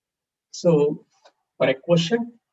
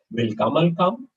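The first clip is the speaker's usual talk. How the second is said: "Will Kamal come?" with pitch climbing on "come"; question intonation. Shown as a demonstration. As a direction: ask it, lifting "come" at the end. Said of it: "change the intonation a little bit".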